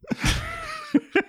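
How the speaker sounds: high-pitched burst of laughter; real high giggle. Real, not acted. Laughing after co-host's remark.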